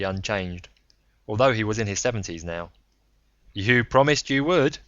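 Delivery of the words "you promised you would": put-on louder, blunt old man's voice; a rough self-made man ribbing him; Braun, gruff old gambler. Braun on the phone.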